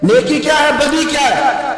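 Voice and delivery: preached question. Shown as a demonstration. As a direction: hammer each word at the crowd. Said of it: "repeats the question preaching".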